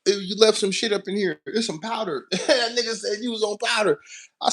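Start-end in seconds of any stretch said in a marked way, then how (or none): 0.1-2.3 imitating a suspicious Russian coworker, higher; mock-alarmed questions in a raised voice; voicing the Russian crew's shock at the truck; Russian coworker quoted. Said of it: "He was like".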